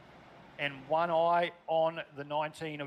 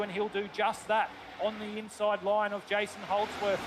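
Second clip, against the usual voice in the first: raised, excited race commentary; live play-by-play; 5 semitones higher than usual. Acted. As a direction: call the race as it unfolds.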